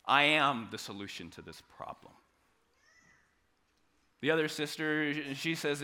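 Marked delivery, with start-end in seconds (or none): 0.0-2.1 calm assurance. Pretend. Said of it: "Jesus says".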